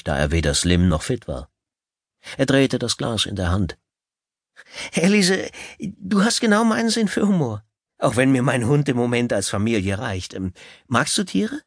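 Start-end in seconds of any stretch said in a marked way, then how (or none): 4.9-11.6 high, eager date voice; a keen, nervous suitor; eager nervous man. Acted. Gail tries to charm.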